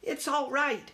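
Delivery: put-on high, confident young mouse; small mouse, sure of himself; reassuring young mouse. A narrator's reading. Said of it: "Ralph assured his mother".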